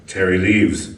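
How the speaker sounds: ordinary delivery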